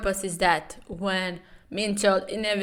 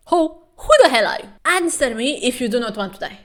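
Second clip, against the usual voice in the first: higher, louder, fast threatening yell; angry demand; 4 semitones higher, 6 dB louder, faster than usual. Retold or imitated. Hero yelling a threat at the creep in the apartment. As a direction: bark the threat at the stranger. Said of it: "the bomb asks".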